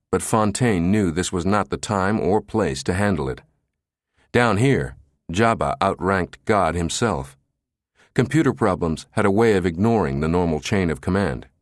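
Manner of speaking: plain reading voice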